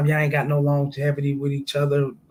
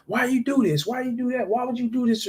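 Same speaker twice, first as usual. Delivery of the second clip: put-on arguing boyfriend; 8 semitones higher than usual. Man arguing with girlfriend.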